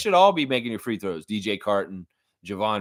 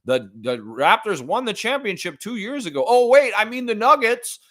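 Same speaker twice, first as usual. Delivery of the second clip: mocking announcer; 7 semitones higher than usual. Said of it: "It's like".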